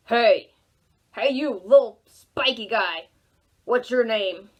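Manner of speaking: loud, low booming rhino; a hearty rhino shouting hello; big rhino voice. Acted. Rhino calls out to porcupine.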